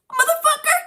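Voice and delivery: high-pitched startled yell; sudden shrill curse. Pretend. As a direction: shriek the curse at the ghost. Mock yelling at a ghost.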